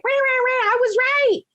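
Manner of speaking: high, taunting victory chant; gloating ghost. Retold or imitated. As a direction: crow it high like a smug ghost.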